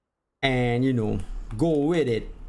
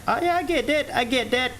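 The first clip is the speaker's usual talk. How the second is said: mock eager agreement; 9 semitones higher, faster than usual. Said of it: "And I'm like".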